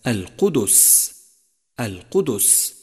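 القدس said slowly as al-qudus; two-syllable qudus in القدس; voweled dal. Shown as a demonstration. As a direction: give the dal of القدس a clear u. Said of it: "فالدال مضمومة وليست ساكنة".

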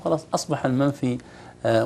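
usual speaking voice

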